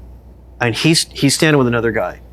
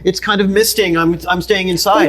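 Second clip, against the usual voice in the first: mock fussy owner; 6 semitones higher than usual. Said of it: "we're like".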